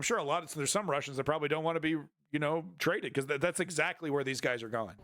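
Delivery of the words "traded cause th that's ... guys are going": normal talking voice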